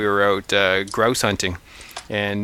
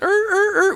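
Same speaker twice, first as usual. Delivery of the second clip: high-pitched "er, er, er" squawks; imitated turkey box call. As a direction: mimic a turkey call in short, high bursts. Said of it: "with the box collar".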